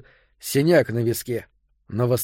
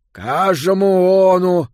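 louder, slow mushy call; mouthless mumble; 6 semitones higher, 7 dB louder, slower than usual. A narrator's reading. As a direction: call out with no real lips. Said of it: "сквозь каплевидное отверстие".

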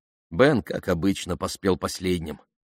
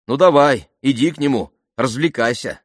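raised, higher, exasperated voice; irritated dismissal; 6 semitones higher, 5 dB louder than usual. A narrator's reading. Pamela telling Ben to go to Jeremy, irritated dismissal. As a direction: send him off in annoyance.

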